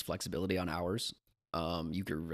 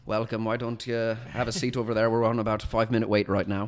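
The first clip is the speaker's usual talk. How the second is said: louder, lifted British or Irish host voice; British or Irish accent; 4 semitones higher, 7 dB louder than usual. Shown as a demonstration. Host seating diners, in a British or Irish accent. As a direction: welcome them in a put-on British or Irish accent. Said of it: "I'd usually be either British or Irish".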